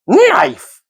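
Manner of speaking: sudden high creature cry; Gollum's guess. A narrator's reading. Gollum finally blurting a guess at Bilbo. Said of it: "he said at last".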